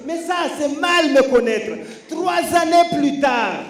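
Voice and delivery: high loud slow comic declaration; punchy stand up comedy. Acted. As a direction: land the comeback line big. Punchline build.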